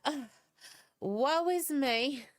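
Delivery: high, drawn-out mock wail; dramatic Southern lament. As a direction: ham up the woe like a dramatic Southerner. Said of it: "Southern people gonna be like".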